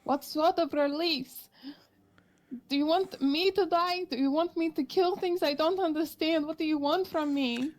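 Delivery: a frightened adventurer pleading with a monster; desperate plea. Acted. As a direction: beg the creature for an answer. Character pleads with nightmare creature.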